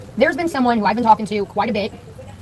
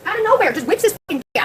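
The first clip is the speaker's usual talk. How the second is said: emphatic insistence; 10 semitones higher than usual. Real, not acted. Insisting to listeners, shocked.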